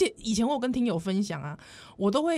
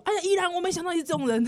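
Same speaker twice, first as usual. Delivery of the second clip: imitating shocked listeners, a bit faster; a playful gasp of disappointment; hammed-up scandalized fan; mock listener outrage; 6 semitones higher, faster than usual. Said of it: "很多人就说".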